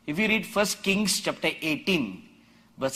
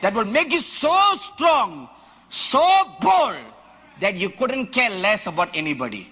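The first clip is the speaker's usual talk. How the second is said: much higher, louder shouted preaching; full-force preaching; 7 semitones higher, 5 dB louder than usual. Acted. Preacher rousing the congregation about a wild ox, shouting. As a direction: thunder it at the congregation.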